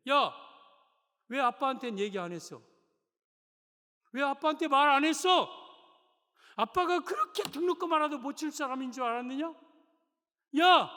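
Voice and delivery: angry father. Acted. Father scolds his son for begging from neighbours, loud angry outburst. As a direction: shout at him for not asking dad.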